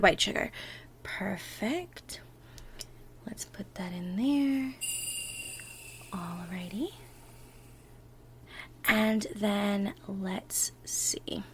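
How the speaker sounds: slow, soft whisper. Acted. Partner starting the baking together, slow soft whisper. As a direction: whisper gently as if not to wake anyone.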